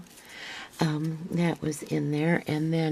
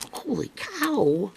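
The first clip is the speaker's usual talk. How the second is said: amazed; 9 semitones higher, slower than usual. Real, not acted. Amazed at extras in box.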